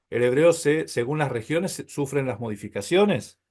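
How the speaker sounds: ordinary talk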